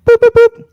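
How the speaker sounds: high, loud beeping buzzer noise; shouted boop buzzer; mock buzzer. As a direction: imitate a game-show timer buzzer. Buzzer sound for time up.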